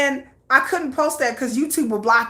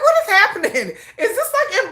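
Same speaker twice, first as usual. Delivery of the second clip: mock confused surprise; 9 semitones higher, faster than usual. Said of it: "I was like".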